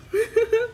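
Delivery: high, breaking giggle. Real, not acted. Fan joking with viewers about going to jail, high giggle. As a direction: laugh at your own joke. Laughing at own jail joke.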